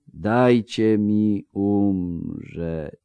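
words dragging out as he drifts off; fading, very slow. Acted. Wounded man sinking under healing magic, very slow. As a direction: let each word sink, barely holding on. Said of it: "mówił coraz wolniej pod wpływem magii".

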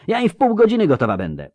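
high, fast volunteering; eager cry. A narrator's reading. Milwa promising to be ready, eager quick cry. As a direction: pipe up in a hurry, keen to go. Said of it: "krzyknęła zrywając się Milwa".

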